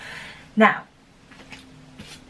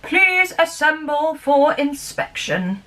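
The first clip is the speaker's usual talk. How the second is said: slow, a bit higher, formal cat voice; an old cat calling the toys to order; 4 semitones higher, slower than usual. Acted. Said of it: "said Bunting".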